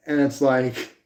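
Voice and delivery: ordinary delivery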